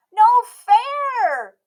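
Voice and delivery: put-on high, loud child's yell; a girl yelling at unfair news. A narrator's reading. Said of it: "yelled Emmy".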